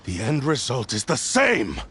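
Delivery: usual speaking voice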